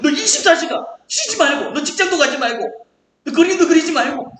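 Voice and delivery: high, loud list of prohibitions; mock threatening. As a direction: bear down like a menacing taskmaster. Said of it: "막 이렇게 협박하면서".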